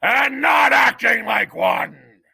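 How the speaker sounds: head mouse shouting from atop the bricks at Despero; shouting judge. A narrator's reading. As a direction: thunder it down from above. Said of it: "shouted the most very honored head mouse".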